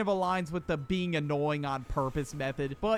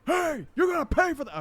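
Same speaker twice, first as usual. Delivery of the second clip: high, loud mock yell; exaggerated retold shout; 11 semitones higher, 6 dB louder than usual. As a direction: call out after a thief, hammed up. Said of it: "I was not that loud".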